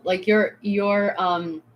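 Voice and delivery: ordinary delivery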